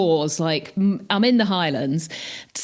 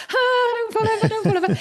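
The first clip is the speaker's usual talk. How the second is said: mock panic; 13 semitones higher than usual. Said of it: "I was like".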